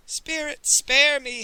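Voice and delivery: high, loud terrified howl; howled plea. A narrator's reading. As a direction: howl for mercy from a vengeful ghost. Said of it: "howled Pawnbroker Fang".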